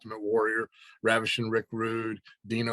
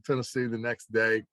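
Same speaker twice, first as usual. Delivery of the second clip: usual speaking voice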